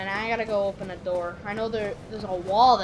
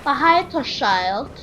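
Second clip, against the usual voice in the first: slow, higher mock British drawl; mock British accent; 5 semitones higher, 4 dB louder, much slower than usual. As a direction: stretch it out in broad fake British. Exaggerated repeat in put-on accent.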